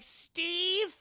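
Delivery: high, drawn-out searching call; acted tentative call for someone missing; questioning call. Maitre d' searching for Steve.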